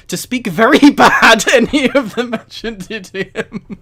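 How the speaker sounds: loud, slow, laughing through the words; can barely get it out for laughing; reading aloud while cracking up. Real, not acted. Reading absurd line amid laughter.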